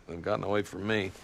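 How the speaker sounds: ordinary talk, nothing marked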